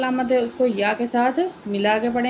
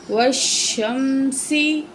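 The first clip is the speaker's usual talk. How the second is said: every sound stretched very slowly; slow blended reading; much slower than usual. Shown as a demonstration. Teacher joining syllables into a word for the pupil, very slow. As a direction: draw the joined word out carefully. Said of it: "کیا بن گیا؟".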